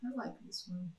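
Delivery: softly voiced character choice; quiet pick. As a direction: say it low-key and soft, poking in. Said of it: "he's like".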